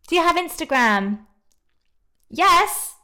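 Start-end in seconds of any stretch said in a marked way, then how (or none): 2.3-3.0 excited yes. Real, not acted. Eager answer to fan question.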